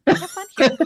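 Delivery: high mock boss cheer. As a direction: chirp it like a fake-happy manager. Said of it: "be like".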